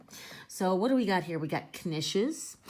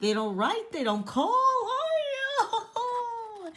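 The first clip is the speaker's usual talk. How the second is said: high, loud wailing complaint; hammed-up high kvetching with a sob; kvetching mother; 3 dB louder than usual. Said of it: "here's how she's kvetching".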